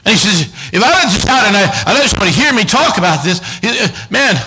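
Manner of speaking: acted outburst building to a high shout; the psalmist's bottled-up worry bursting out; full-force preaching. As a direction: preach it up into a yell.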